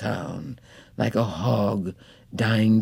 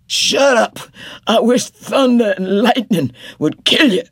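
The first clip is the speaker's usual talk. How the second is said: much higher and louder, shouting; furious outburst; 10 semitones higher, 6 dB louder than usual. Acted. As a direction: scream her silent from the sickbed. Dying husband's outburst.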